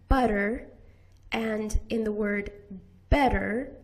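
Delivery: butter and better with a quick flap; better said with a fast tongue tap; flapped t. Shown as a demonstration. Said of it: "we use the flap".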